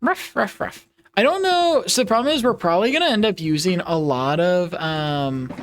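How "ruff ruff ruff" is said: playful mock dog woof; puppy barks. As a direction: yap like a little puppy. Puppy persona barking noises.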